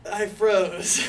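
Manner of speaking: laughing while telling it. Real, not acted. Laughing as he tells friends he froze. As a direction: giggle through the words. Nervous laugh retelling freezing.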